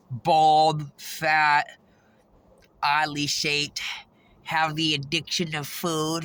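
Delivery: ordinary talk, nothing marked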